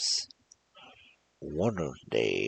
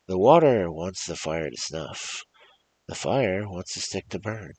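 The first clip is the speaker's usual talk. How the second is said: lively storybook read-aloud, louder on each new character; story narration; 4 semitones higher, 8 dB louder than usual. Acted. Reading a folk tale to viewers, lively storybook narration. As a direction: tell the cumulative tale to listeners, building each line.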